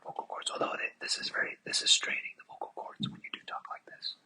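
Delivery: very soft, voiceless whisper; hushed demo of ASMR talk. Shown as a demonstration. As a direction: whisper it low like an ASMR video. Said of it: "It's like speaking really low, soft".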